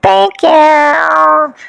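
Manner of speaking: exaggerated high, stretched-out imitation; mocking high-pitched put-on voice; mock influencer thanks. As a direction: mimic a gushing influencer, dragging the words out.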